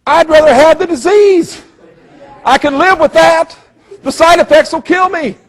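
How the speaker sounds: very high, loud mock squeal; hammed-up high-pitched protest; horrified TV viewer. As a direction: squeal it in comic alarm. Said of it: "you're sitting there going".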